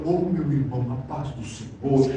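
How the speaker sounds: a syrupy holy-voice parody; churchy put-on voice. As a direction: imitate an overly pious brother. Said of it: "Ele muda a voz".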